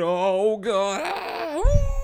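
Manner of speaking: high mock howl, climbing steeply; hammed-up agony rising into a wolf howl; werewolf transformation. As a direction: cry out as the full moon changes you. Acting out turning into a werewolf.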